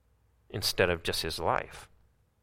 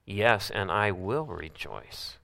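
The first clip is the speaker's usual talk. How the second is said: WILL drawn out, slow and softer; slow emphatic will; 4 dB quieter, slower than usual. Shown as a demonstration. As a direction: lean slowly into WILL. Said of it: "In this I rejoice".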